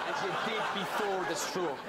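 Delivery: ordinary delivery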